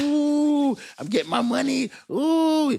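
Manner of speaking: hammed-up greed over free money; mock giddy excitement. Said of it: "everybody's like".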